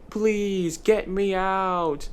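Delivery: high, drawn-out pleading wail. Acted. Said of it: "Dennis wailed".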